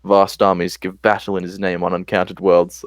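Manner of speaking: put-on solemn epic prologue in an Australian accent; dramatic Australian accent. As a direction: intone it gravely, Australian accent. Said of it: "my dramatic Australian voice".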